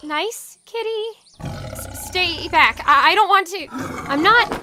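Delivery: loud frightened shouting at the cat; scared yelling. Acted. Dawn facing a big cat, scared and shouting. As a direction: yell to scare off the animal.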